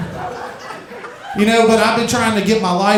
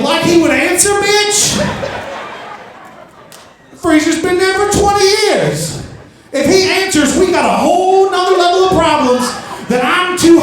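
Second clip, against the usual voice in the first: incredulous mock retort; 10 semitones higher than usual. Comic mocking his mom at the freezer, high laughing disbelief.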